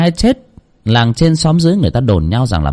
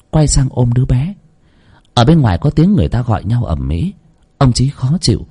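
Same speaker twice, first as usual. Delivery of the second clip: plain reading voice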